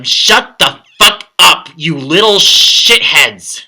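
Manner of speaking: loud, drawn-out angry yell, high at the start; angry scream. Acted. Fed-up reviewer screaming at the annoying chanting characters. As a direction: scream each word out slowly in exasperation. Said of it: "just scream at them".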